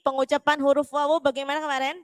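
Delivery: normal talking voice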